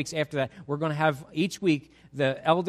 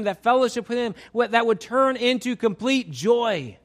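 high, loud, ringing sermon climax; full-force preaching; 10 semitones higher, 8 dB louder than usual. Acted.

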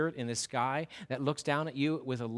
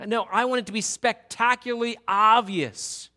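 loud, higher, full-force preaching as God; God proclaiming; 7 semitones higher, 8 dB louder than usual. Acted. Preacher voicing God to the congregation, loud passionate proclamation. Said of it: "And here God says".

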